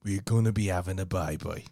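low Brummie impression, drawn to a fall; badly faked Brummie; Brummie accent. Shown as a demonstration. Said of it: "That's really shit".

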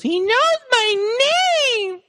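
high, slow breathless awe; awed child. Retold or imitated. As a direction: gasp it like a starstruck kid. Kid's awed line.

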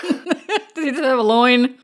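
amused, breaking up with laughter; laughing. Real, not acted. Laughing at the loincloth slip.

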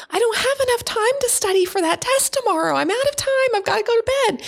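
put-on high, panicked teen voice; stressed kid. Kid panicking about tomorrow's test, high frantic voice. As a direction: whine it like a worried student. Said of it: "So the kid's saying".

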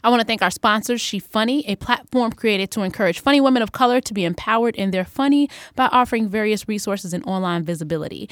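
loud, projected announcer-like thanks; sponsor read. Real, not acted. Host thanking sponsors at show's end, projected read. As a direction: read the credits out brightly and evenly.

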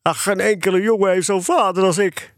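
high-pitched acted wail of despair; hammed-up tearful outburst; sobbing despair. A narrator's reading. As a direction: cry out as a hopelessly wronged boy. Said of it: "Hij snikte het opeens uit en riep een diepe wanhoop uit".

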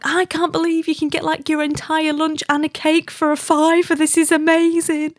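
high-pitched gushing amazement, hammed up; enthralled husband. Husband marvelling at a cheap pie shop lunch, enthralled. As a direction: imitate someone thrilled by a bargain. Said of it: "he was just enthralled".

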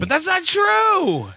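high, drawn-out whiny objection; mock protest. Host contradicting co-host's intro, mock protest.

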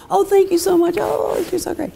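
very high, gushing fake gratitude; mock-thrilled girl. Girl thanking someone for mere crumbs, mock-thrilled. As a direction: parody an overly grateful girl in a high voice. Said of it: "supposed to be thrilled".

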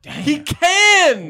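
very high, loud incredulous cry; emphatic insistence. Real, not acted. Insisting to the room about his friend, exasperated. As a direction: drive the point home at the top of the voice.

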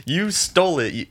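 playfully brand her a bandit; teasing the friend who stole his food; mock accusing. Speaker retells accusing friend.

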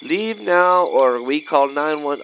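slow, spelled-out read; reading a sign. Acted. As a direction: read slowly off a sign, word by word. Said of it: "digital sign up above the door that says".

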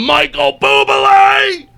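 high, drawn-out name announcement; stretched, rising stage introduction; showbiz announcer. Pretend. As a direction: belt the name like an emcee. Announcing name in mock showbiz story.

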